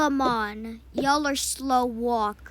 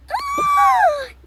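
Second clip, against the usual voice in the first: loud gasp squeaking way up high; shrieking breath in; excited gasp; 11 dB louder than usual. Acted. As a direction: gasp of pure delight. Child thrilled again.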